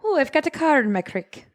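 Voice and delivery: high, lilting put-on Irish; mock Irish accent. Co-host showing off for the podcast, hammy Irish accent. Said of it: "with an Irish accent".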